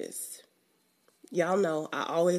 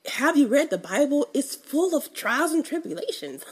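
incredulous; 8 semitones higher, 3 dB louder than usual. Real, not acted. Incredulous rhetorical question.

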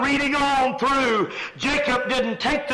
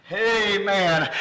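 usual speaking voice

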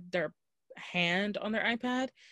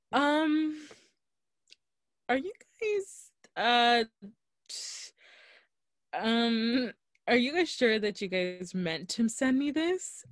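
nervous high-pitched asking, much higher; timidly checking they meant it, pitched up; hesitant, higher; 4 semitones higher, slower than usual. Retold or imitated. To the company, unsure they meant to pay, in a nervous high voice.

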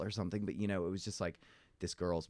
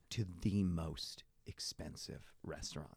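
slow, softer, weighty delivery; drawn-out emphasis; 6 dB quieter, slower than usual. Acted. Mocking Americans who overspend on dinner, slow and drawn out. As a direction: draw out each word for mock importance.

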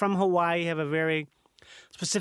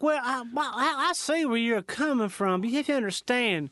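gruff Southerner. Acted. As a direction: play a put-upon Southern gentleman. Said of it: "like gruff Southerner".

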